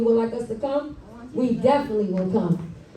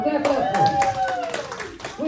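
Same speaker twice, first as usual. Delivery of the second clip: stretched slowly and pitched way up; drawn-out hype call; much slower than usual. Real, not acted. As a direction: whip the crowd into clapping. Hyping crowd to applaud.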